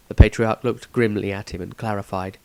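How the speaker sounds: ordinary delivery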